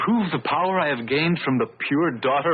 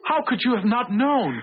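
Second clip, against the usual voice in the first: accusing outcry; 10 semitones higher than usual. Acted. Accusing Beatrice in quarrel.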